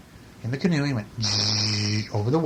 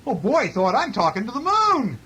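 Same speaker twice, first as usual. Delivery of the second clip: high-pitched storybook excitement; excited bear; 9 semitones higher than usual. A narrator's reading. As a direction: Bear thrilled, pitch leaping up. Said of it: "Bear got excited".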